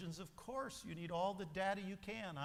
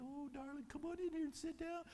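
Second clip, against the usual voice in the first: much softer and higher sweet coaxing, quick; tender welcome; 8 semitones higher, 8 dB quieter than usual. Acted. Preacher voicing a loving mother at an intervention, soft and sweet. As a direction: coo at them like a doting mother.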